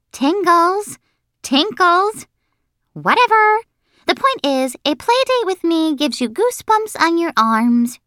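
high, huffy schoolgirl; squeaky high, then brushing it aside; dismissive bossy girl. Acted. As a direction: wave off the correction impatiently.

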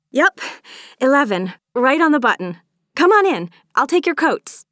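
high, loud, eager host; excited. A narrator's reading. Charlotte takes the guests' coats at the door, excited. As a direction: welcome them in with rising excitement. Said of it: "Charlotte said excitedly".

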